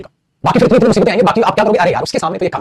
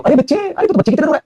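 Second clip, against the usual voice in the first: mock teasing a kid; 9 semitones higher than usual. Teacher acts scolding a child.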